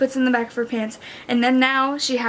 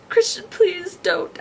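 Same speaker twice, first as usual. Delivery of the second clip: slow, higher-pitched tearful plea; acted anguished begging; fighting back tears; 6 semitones higher than usual. A narrator's reading. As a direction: plead slowly, as if holding back tears. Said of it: "I fight back tears".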